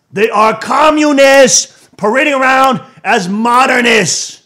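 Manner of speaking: loud, high, slow tirade; fiery preaching. Real, not acted. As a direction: shout it like a sermon. Preaching at full force.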